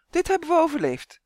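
high, cheerful elf calling out; triumphant little cry after the rapids; bright elf voice, glad to be alive. Acted. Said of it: "riep hij opgewekt".

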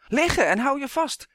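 high, loud urgent command; shouted warning. A narrator's reading. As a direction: shout to take cover right now. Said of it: "schreeuwde hij". (dringend geschreeuwde waarschuwing)

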